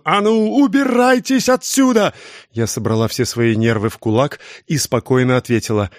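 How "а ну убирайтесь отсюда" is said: high, piercing scream to get out; shrill yell. A narrator's reading. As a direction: screech her out of the house. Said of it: "выкрикнула противным визгливым голосом". (визгливый крик, намного выше)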